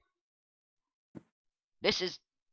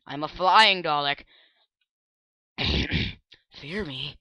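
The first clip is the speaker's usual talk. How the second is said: ordinary delivery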